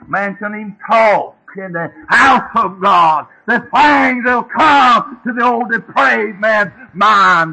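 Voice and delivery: slow, loud and high, driving each word; preaching at full force. Real, not acted. Preacher to his congregation, shouting it out slowly. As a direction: build it to a thundering climax in the pulpit.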